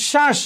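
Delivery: শ্বাস said as shash, with no b; silent b. Shown as a demonstration. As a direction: drop the b in শ্বাস entirely. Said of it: "এখানে ব ফলার উচ্চারণ হয়নি".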